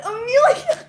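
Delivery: high, loud call with a laugh in it; calling out a name. Retold or imitated. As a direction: call her name like a caught-out mom. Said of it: "And she said".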